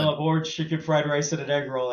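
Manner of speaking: lightly voiced Shaq ordering for his friend; a hint of big-man swagger, playful; imitating Shaq. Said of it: "he's like".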